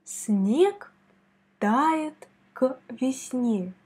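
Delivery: тает к весне said very slowly and evenly; slow demo. Shown as a demonstration. Teacher slowly reads the sentence for student. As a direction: spell it out slowly.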